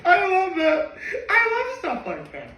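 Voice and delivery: high-pitched, laughing delight; delighted gushing. Real, not acted. Fan reacting to a favourite scene, high laughing gush. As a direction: squeal with real delight.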